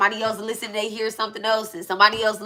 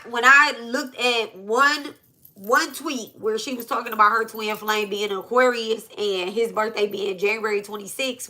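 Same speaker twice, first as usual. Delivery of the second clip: ordinary talk, nothing marked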